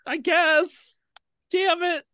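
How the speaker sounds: squeaky high little voice; the shrunken Minotaur pipes up; tiny squeak. Acted.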